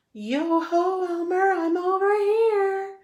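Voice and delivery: high, loud, slow distant call. Acted. Wilbur calling out to Elmer from hiding, high and loud. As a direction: throw the voice like a far-off ventriloquist call.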